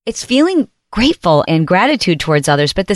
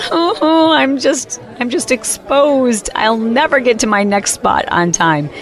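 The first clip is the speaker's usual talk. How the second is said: higher, stretched-out fretting; mock panic about being late; a touch of dread, slow on exposed; anxious inner voice; 5 semitones higher, slower than usual. Her own anxious thoughts.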